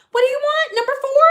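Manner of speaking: high, loud call to the crowd. Real, not acted. Singling out audience member, loud high call. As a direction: call across the room.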